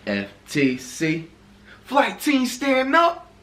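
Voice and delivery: loud hyped-up show host; hype intro. Acted. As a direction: shout it out like an intro. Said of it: "what kind of intro was that".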